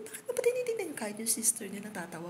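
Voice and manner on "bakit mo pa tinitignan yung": much higher quoted fiancé; playful quote. Retold or imitated. Quoting fiancé asking why she checks prices, high teasing. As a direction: pitch up to play him teasing her.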